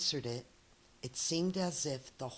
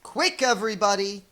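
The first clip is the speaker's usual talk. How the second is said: louder, fast rallying call; shouted; 3 semitones higher, 8 dB louder, faster than usual. Acted. Dex shouting to rally the crowd. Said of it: "Dex shouted".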